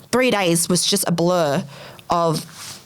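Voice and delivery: usual speaking voice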